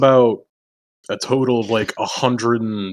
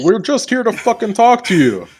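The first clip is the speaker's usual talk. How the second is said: earnest plea; 9 semitones higher than usual. Acted. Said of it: "he's gonna, like, stick his face in the hole".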